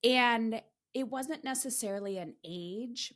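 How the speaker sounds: ordinary delivery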